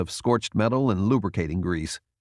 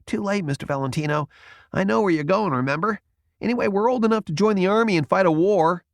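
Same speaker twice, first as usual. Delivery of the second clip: insistent youth; 10 semitones higher, faster than usual. A narrator's reading. Jason insists.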